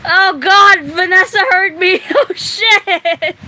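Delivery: loud, high, laughing dismay; real flustered laughter; laughing embarrassment. Real, not acted. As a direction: blurt it out, caught and cracking up. Caught out by Vanessa, laughing.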